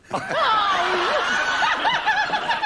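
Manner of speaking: high loud burst of laughter; big laugh. Real, not acted. Singer roars with laughter at being called madam.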